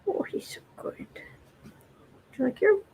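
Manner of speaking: high, breathy whisper to a pet; whispered pet talk. Real, not acted. Owner whispering to her cat mid-reading.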